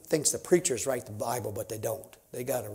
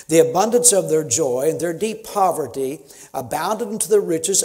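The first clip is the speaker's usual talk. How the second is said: loud, fiery scripture reading; thundering King James reading from the pulpit; preaching at full force; 3 semitones higher, 8 dB louder than usual. Acted. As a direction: preach the verses to the rafters.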